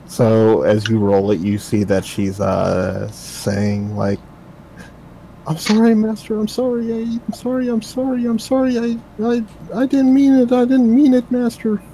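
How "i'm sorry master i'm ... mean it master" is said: high, frantic begging, voice way up; terrified pleading girl. Acted. Captive girl begging her master in high terror.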